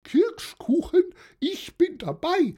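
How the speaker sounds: put-on very high-pitched gleeful old lady; squealing-high joy from a merry old friend; delighted. A narrator's reading. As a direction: jump at the offer, high and loud. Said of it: "freute sich Lore".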